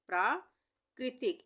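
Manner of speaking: प्राकृतिक slow, प्रा high and loud, then कृतिक; broken-up dictation. Shown as a demonstration. Teacher repeating for spelling, प्राकृतिक split after a high प्रा. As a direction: lift प्रा, pause, drop to कृतिक.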